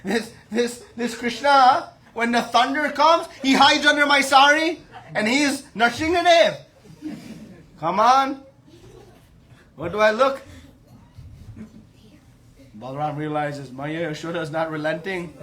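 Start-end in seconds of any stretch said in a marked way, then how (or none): 0.0-10.4 loud, high, exasperated dismissal; scoffing scolding. Acted. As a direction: send the boaster packing, incredulously. Yashoda scoffs and chases Balram off.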